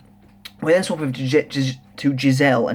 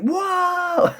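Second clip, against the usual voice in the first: high shocked yelp; mock startled cry, much higher; startled whoa. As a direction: leap way up in pitch, caught off guard. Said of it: "Bazby's just kind of like".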